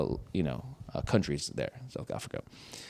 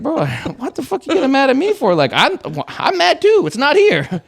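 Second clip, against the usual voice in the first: higher, laughing exasperation; exasperated retort; 10 semitones higher, 4 dB louder than usual. Retold or imitated. To the angry tech, higher exasperated laughing retort. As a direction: throw it back at him.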